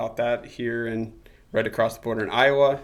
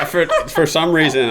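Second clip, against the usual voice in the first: laughing; 6 semitones higher, 8 dB louder than usual. Real, not acted. Son continues his farm joke.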